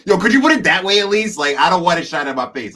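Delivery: high, loud, exasperated protest; exasperated outburst. Retold or imitated. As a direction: blurt it out high and fed up, almost laughing. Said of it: "I'm like".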